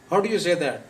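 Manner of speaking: ordinary delivery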